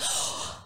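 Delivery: sharp voiceless gasp of panic; panicked gasp. Retold or imitated. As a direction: suck in air like you're panicking. Panic attack retold in ocean.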